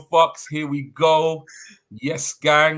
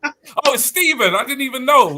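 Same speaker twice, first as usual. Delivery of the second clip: high, loud surprised exclaim; 11 semitones higher, 6 dB louder than usual. Acted. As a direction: act shocked at the reveal. Host reacts to Santa unmasking.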